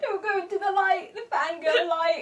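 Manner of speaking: very high laughing wail; overwhelmed fangirl laugh-cry, pitch way up. Real, not acted. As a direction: squeal it out, half laughing half crying. Overwhelmed fangirling over pictures.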